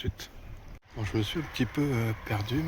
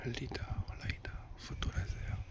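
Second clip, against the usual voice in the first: whispering; 2 semitones higher, 6 dB quieter than usual. Real, not acted. Whispering the word lidar.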